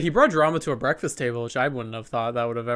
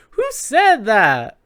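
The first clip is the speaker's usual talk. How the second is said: drawn-out, high, loud mimicked question; Phaedra's demand; 7 semitones higher, 8 dB louder, much slower than usual. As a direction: stretch it out slowly, loud and high. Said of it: "Phaedra goes".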